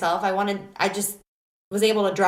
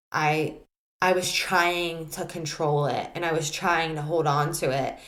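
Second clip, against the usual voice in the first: usual speaking voice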